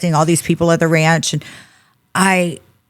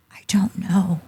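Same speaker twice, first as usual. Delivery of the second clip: small whispered admission of fear; scared, lost; 6 dB quieter than usual. Retold or imitated. Woman unable to say where she lives, scared whisper. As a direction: whisper it, soft and helpless. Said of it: "was just so scared in that moment".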